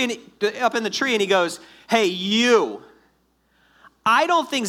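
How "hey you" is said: loud mock call up to someone. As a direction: punch it out like hailing someone overhead. Jesus calls up into tree.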